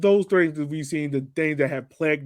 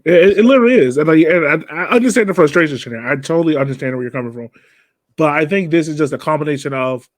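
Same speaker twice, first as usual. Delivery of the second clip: emphatic; 10 dB louder than usual. Real, not acted. Podcast host pushing back on Shannon.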